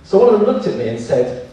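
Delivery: ordinary delivery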